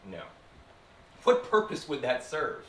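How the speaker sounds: ordinary delivery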